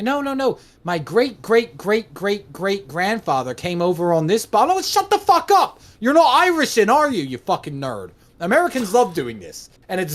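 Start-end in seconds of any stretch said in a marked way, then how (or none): 0.0-4.7 high-pitched, mock-protesting American; hammed-up insistence on a family claim. American insisting on distant Irish ancestry, mock insistence. 4.7-8.1 high-pitched, hammed-up outburst; exasperated scorn. Mocking an American who claims Irishness, exasperated scorn.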